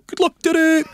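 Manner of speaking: very high, loud mock Echt accent; broad Echt accent. All the staff greeting him in the morning, mock broad Echt accent. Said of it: "in this broad Echt accent".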